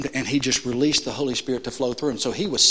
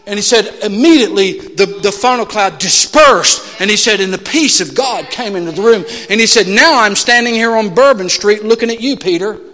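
preaching; 6 semitones higher, 9 dB louder than usual. Acted. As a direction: let the peace land with force. Resolution of the story, still preaching.

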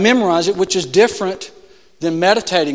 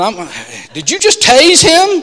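alarmed cop; 8 semitones higher, 10 dB louder than usual. Retold or imitated. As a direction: accuse him of using a taser. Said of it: "the cops looking and said".

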